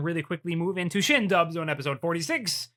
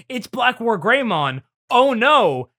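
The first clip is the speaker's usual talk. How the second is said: higher, louder hammed-up cry of alarm; mock alarm; 7 semitones higher, 6 dB louder, slower than usual. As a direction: play the panic big and silly. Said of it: "there's an exchange that goes".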